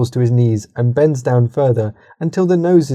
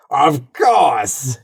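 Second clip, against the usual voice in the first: Imperator voice; 2 semitones higher than usual. Acted. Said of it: "the Imperator voice".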